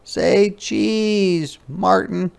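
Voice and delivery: put-on high, cheerful sing-song girl; a child happily posing the clouds; little girl voice. Ruthie playfully asks the clouds to smile, high sing-song. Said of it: "She tried it out".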